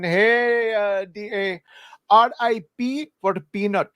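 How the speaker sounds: put-on comic high Indian-accented reading. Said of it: "read some super chats in the voice".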